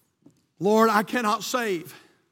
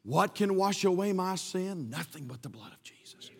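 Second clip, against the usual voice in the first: hushed hymn line; 4 semitones lower, 8 dB quieter than usual. Acted. Preacher quoting the hymn to the church, softly. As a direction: speak the hymn low.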